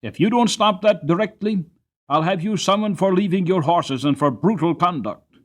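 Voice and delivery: loud, high, firm warning; decided voice. Acted. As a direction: stop the carter cold with a stern threat. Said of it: "said in a decided voice".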